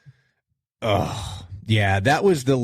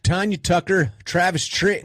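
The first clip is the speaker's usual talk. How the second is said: put-on Southern accent; 9 semitones higher than usual. Host listing 1994 country halftime acts in Southern accent. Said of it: "I just did it with a Southern accent".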